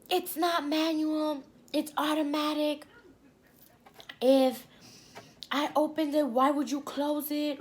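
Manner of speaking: high, drawn-out scolding, mimicking the driver; imitated going off. Cab driver scolds passenger over the trunk, mock angry and high. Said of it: "He's going off on me".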